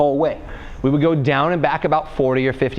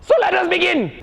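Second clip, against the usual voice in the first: So let us begin pitched up with a lift on let; more energetic. Shown as a demonstration. Coach restarting the warm-up intro, upbeat German accent. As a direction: relaunch the line with fresh coach energy. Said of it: "repeats the line with more energy".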